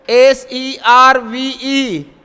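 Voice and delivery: very slow, letter by letter; slow letter-by-letter spelling. Shown as a demonstration. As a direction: spell each letter out slowly. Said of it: "spelling letters slowly".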